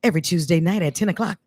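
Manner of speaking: low, measured TV promo read; voiceover. Pretend. Host auditioning playfully as network announcer, low voiceover. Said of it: "to be the voiceover for own".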